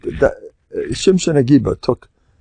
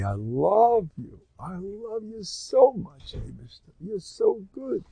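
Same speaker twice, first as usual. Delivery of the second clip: much softer, slow, lifting high on the love; tender whispered prayer; 8 semitones higher, 10 dB quieter, slower than usual. Shown as a demonstration. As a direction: murmur it with your whole heart to God. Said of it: "I recommend saying this every day".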